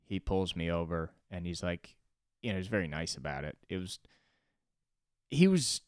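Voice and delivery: normal talking voice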